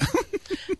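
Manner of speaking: high, short laugh; joking chuckle. Real, not acted. Shop owner teasing host about volunteering, high chuckle. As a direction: laugh at your own joke.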